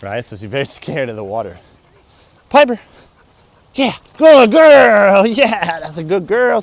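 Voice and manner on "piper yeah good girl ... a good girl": excited dog praise. Real, not acted.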